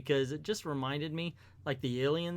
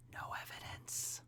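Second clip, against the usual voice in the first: whispered aside; 16 dB quieter than usual. Real, not acted. Aside about the bird.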